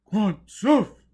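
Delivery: very high, very loud chanted cry; chorus shout. A narrator's reading. Crowd chorus answering Joseph's verdict. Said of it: "came the chorus".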